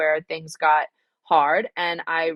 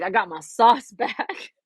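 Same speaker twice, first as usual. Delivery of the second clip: ordinary delivery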